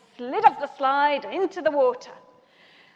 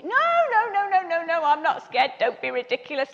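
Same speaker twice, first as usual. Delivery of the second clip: retold bravado. Insisting to the lads she isn't scared, mock bravado.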